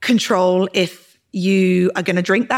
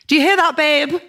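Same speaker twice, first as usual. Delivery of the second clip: calling out; 7 semitones higher than usual. Real, not acted. Calls to boyfriend upstairs.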